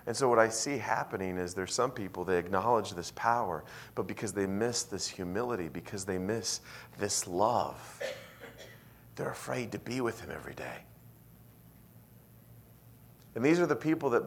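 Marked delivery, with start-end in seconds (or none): none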